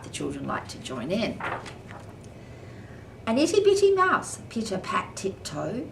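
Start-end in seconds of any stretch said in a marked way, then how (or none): 3.3-4.4 squeaky. A narrator's reading. Tiny mouse described.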